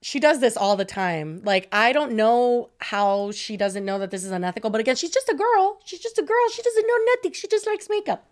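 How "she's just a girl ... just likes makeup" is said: put-on very high-pitched clueless girl act; mock innocent excuse. Influencer's imagined excuse when called out, mock innocent. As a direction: play dumb in a tiny high voice. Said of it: "That's what she's gonna say".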